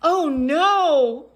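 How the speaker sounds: high, loud oh no; alarmed cry. Acted. Piggy reacts to the sneeze, alarmed. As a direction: gasp it out in alarm.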